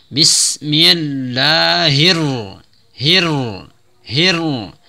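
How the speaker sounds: slow recited drill on hir; tajwid drill. Shown as a demonstration. Teacher drills the ra tasydid, slow chanting. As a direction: repeat hir with the ra pressed hard. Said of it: "Ra tasydid ditekan".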